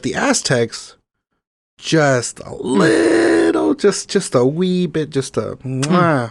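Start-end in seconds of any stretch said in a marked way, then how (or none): 1.8-6.3 coy understatement. Acted. Teasing the audience that the Aztecs were a wee bit obsessed, coy voice. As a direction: play it cute, shrinking the obsession.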